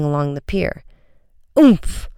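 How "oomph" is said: high, sudden oomph; winded collision noise; impact grunt. A narrator's reading. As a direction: grunt as if knocked into. Collision with a running man.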